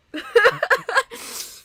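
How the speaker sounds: high, loud laugh to shake off crying; forced laugh through tears. Real, not acted. Streamer laughing at her own crying to lighten the mood, high and loud. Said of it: "I gotta make myself laugh".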